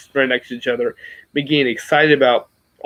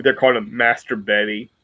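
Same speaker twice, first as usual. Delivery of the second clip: usual speaking voice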